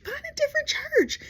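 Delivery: very high, pleading "different"; squealed advice. Real, not acted. Comedian urging James again, very high. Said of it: "just find a different church".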